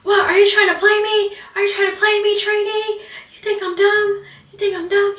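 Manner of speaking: high, loud shouting as the trainer; imitated screaming. Trainer screams at trainee over push-ups, high furious yell. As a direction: yell it like an irate drill instructor. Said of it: "she started screaming".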